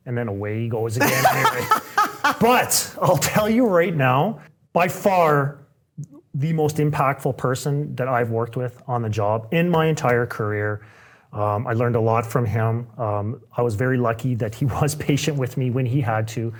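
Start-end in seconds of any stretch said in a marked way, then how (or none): none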